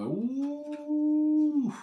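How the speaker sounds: normal talking voice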